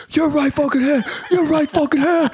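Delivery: very high, strained mock yell; imitated high-pitched sergeant yelling; drill instructor. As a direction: bark the correction like an exasperated instructor. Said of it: "the drum instructor's like".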